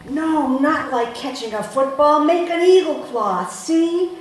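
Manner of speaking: loud, high barking instruction. Retold or imitated. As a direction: bark it at the customer. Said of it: "barks the fishmonger".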